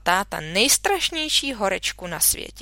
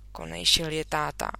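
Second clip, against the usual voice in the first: ordinary delivery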